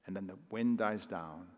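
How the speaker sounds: ordinary talk, nothing marked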